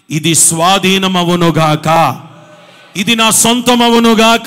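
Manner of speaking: loud, slow proclamation; bold prayer declaration. Shown as a demonstration. Pastor showing the congregation how to pray, loud and slow. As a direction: declare it like a claim. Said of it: "చేతులుంచి ప్రార్థన చేయి".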